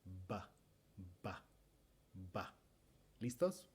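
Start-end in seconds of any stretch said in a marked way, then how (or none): 0.0-2.5 "Ba" con voz, labios vibrando; b sonora. Shown as a demonstration. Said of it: "practiquemos un poco la versión sonora". (b sonora repetida)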